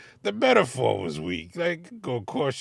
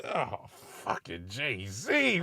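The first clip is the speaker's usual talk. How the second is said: usual speaking voice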